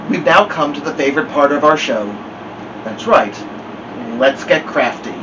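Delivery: plain reading voice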